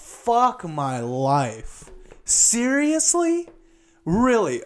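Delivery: high, drawn-out exasperated "seriously"; slow, high, fed-up "Seriously?". Real, not acted. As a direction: let it climb way up in disbelief. Exasperated when the unit shuts off.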